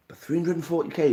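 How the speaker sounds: disbelief. Real, not acted. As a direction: push back in amazement. Questions the figure again.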